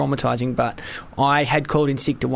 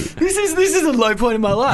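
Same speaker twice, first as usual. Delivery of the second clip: laughing self-mockery; 10 semitones higher than usual.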